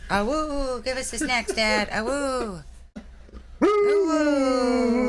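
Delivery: high playful woo howls; howling like a wolf pup. Pretend. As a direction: howl like a pup begging. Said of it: "howling like a wolf pup with dog".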